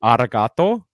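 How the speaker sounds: "Arigato" said loudly with a rolled R. Shown as a demonstration. Showing friends Japanese thanks, "Arigato" with rolled R. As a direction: roll the R firmly in "Arigato". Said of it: "So you always roll the R".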